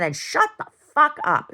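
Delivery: high and loud; retold angry shout. Telling off the guest father, high loud angry retort. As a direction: snap it at him.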